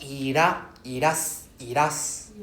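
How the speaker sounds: ira, then iras with a rising tune; broken into parts. Shown as a demonstration. Teacher breaks irashaimase into parts. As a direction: build iras piece by piece, each part rising. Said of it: "We separate the S".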